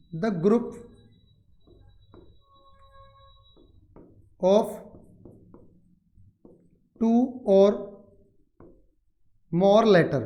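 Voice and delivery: word by word with very long pauses; dictation. Shown as a demonstration. Teacher gives students the word definition, slow dictation. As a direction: dictate slowly, leaving time to write.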